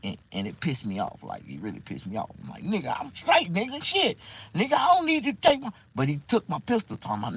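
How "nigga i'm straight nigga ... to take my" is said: high-pitched, irritated objection; indignant protest. Retold or imitated. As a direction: insist you're fine, voice rising. Acts out protest to cousin.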